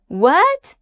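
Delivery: "What?" climbing high at the end; surprised upward "What?"; surprised rising tone. Shown as a demonstration. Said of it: "Hear how it rises?".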